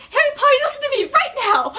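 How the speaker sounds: high, loud, fast urgent command; mock Luna urgency; imitating Luna. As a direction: order him to listen. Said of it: "And then Luna".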